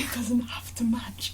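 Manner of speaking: laughing whisper; whispered echo. Pretend. Echoing her friend's quote, laughing whisper. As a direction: breathe the line back with a laugh.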